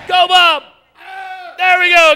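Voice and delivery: high, loud excited shout; real stage holler; calling out. Real, not acted. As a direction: yell the cue across the room. Cueing the mob leader.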